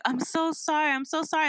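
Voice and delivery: pleading mock apology; apologetic mom. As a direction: apologise over and over to a child. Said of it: "the mom was like".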